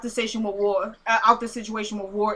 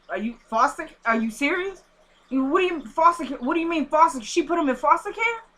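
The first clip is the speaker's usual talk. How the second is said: acted incredulous questions, pitch climbing; shocked disbelief; 4 semitones higher than usual. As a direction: play stunned, each question rising higher. Acting out a shocked phone reaction.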